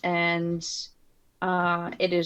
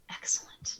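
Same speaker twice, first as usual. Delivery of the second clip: sinister whisper; 11 dB quieter than usual. Acted. Player plots against Nana.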